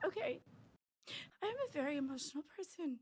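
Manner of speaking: choked quiet. Real, not acted. Streamer apologising between tears.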